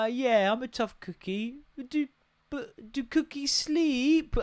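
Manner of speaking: put-on a little girl asking in wonder; toddler voice. Daughter voiced in story.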